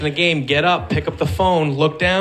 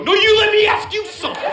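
mock indignant comeback; 13 semitones higher, faster than usual. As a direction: throw her line right back at her. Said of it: "I go".

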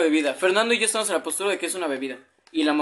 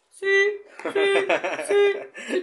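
agudo y cantado, con risa; voz de teléfono fingida; 12 semitones higher, much slower than usual. Retold or imitated. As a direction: responde como máquina complaciente. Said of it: "y empieza". (voz aguda de burla)

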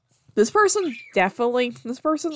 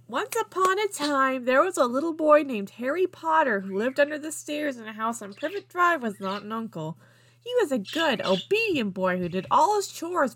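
higher, swooping storybook reading; storybook narration; 6 semitones higher than usual. A narrator's reading. Reader telling Harry Potter fan fiction as a bedtime tale, storybook narration. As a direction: read it like a fairy tale for little kids.

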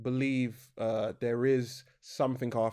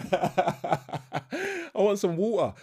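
slow, higher English accent, laughing; exaggerated English accent; 6 semitones higher, 4 dB louder, much slower than usual. Shown as a demonstration. Joking about being English born, exaggerated English accent. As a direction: play up the English 'water' through laughter.

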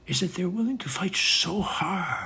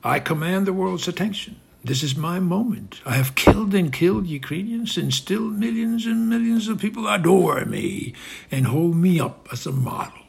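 lightly voiced boastful Putin, a bit higher; proud Putin; 6 semitones higher, 4 dB louder than usual. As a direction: brag as the strongman savoring attention. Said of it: "he says proudly".

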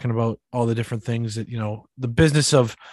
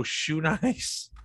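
ordinary talk, nothing marked

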